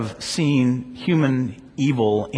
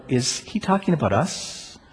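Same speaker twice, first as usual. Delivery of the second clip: normal talking voice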